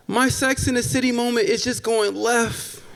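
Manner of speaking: ordinary talk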